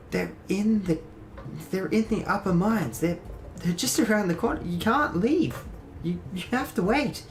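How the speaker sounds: high, halting urgent warning; panicked, pleading character. Acted. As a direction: plead with them nervously, pitched well up. Plays Nezriel warning the party.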